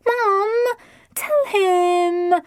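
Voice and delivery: high tearful plea; pleading teen. A narrator's reading. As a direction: beg like a sulky girl. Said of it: "Lydia pleaded".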